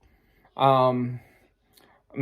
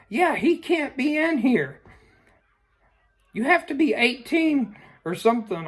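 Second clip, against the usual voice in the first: shouted rule; 10 semitones higher than usual. Retold or imitated. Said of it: "starts shouting at me".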